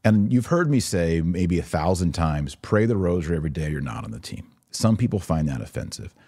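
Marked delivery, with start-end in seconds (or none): none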